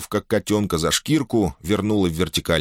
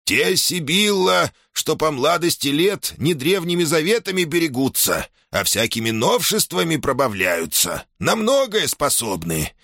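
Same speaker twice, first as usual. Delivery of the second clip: put-on old shaman voice; 9 semitones higher than usual. A narrator's reading. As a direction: croon like a fussy old shaman. Shaman answers in his voice.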